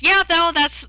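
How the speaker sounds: high startled yelp; sudden yelp. Real, not acted. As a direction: jolt up suddenly. Coffee spasm outburst.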